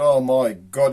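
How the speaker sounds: loud, mildly panicked exclamation; dawning dread, acted; mock alarm at what you've done; blind panic. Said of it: "in a blind panic".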